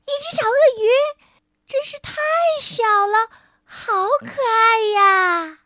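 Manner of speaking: put-on little girl delighted by a tiny crocodile; little girl gushing. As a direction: coo over it like a cute pet. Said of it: "小女孩喊道".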